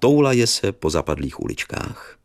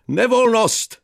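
high, louder call upward; calling upstairs; 4 dB louder, slower than usual. Acted. Narrator calls up the stairs to the teacher, high and loud. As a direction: throw the word up the stairwell. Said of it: "volám nahoru".

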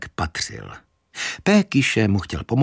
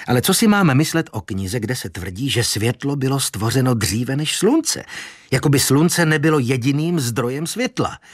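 plain reading voice

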